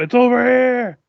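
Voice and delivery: yelling out. Acted. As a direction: call it out high, as if across a distance. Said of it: "I just yell out".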